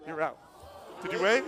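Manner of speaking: ordinary talk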